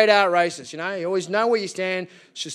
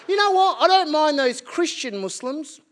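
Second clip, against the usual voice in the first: mock older lady; 8 semitones higher than usual. A lady telling a friend her view, lightly mocked. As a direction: mimic her casual bigotry. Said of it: "she was like".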